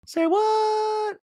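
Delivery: very high, held-out "what"; exaggerated shock. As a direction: squeal it in mock disbelief. Host reacts to shocking remark.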